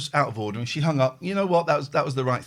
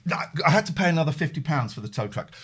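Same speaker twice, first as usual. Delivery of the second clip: usual speaking voice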